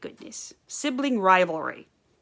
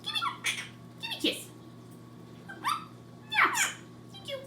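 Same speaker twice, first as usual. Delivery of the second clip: talking to a bird; 8 dB quieter than usual. Real, not acted. High-pitched talk to pet birds.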